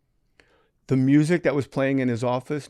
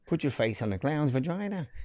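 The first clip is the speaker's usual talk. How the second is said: softer Australian lilt, rising; mock Australian; 2 semitones higher, 6 dB quieter than usual. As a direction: parody Aussie upspeak. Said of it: "sounds like a question".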